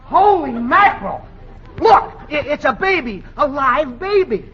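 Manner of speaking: put-on man finds a baby behind the sofa, astonished. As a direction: blurt out the discovery. Discovers the baby.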